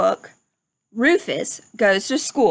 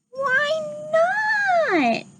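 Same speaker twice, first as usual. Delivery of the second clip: piglet voice. Acted. Rufus asks.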